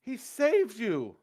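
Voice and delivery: high, very loud, stretched shout; outraged retort. Acted. Man answers the girl's accusation, outraged shout. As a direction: shout them down in disbelief.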